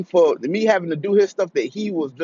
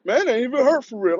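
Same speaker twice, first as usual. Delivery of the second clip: higher mock tough-kid voice; mock his brother's fake bravado; 7 semitones higher than usual. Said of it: "He talking shit".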